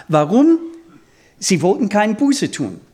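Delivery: ordinary talk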